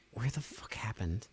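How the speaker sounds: hushed whisper. Real, not acted. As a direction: whisper it under breath. Whispered aside.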